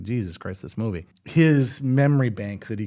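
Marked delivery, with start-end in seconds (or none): 0.0-1.1 low, quiet groan of disbelief; low groaning mutter. Retold or imitated. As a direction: groan it under your breath. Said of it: "audibly grunting and, and moaning".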